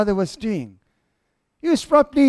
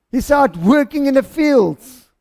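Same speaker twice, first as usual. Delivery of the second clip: ordinary talk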